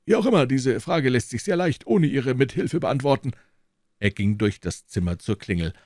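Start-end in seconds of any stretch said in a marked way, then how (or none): 0.0-3.3 put-on a lofty old professor receiving guests; old professor's voice. A narrator's reading. Elderly professor politely greeting visitors, high and measured.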